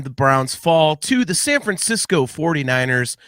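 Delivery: ordinary talk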